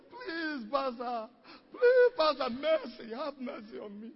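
high, loud crying plea; begging for mercy. Retold or imitated. Fallen man begs pastor for mercy, high crying plea. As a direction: beg the pastor, voice cracking. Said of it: "He said".

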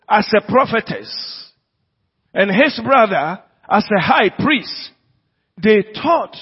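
impassioned sermon delivery; fervent preaching. Acted. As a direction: press the point home to the church. Preaching at full force.

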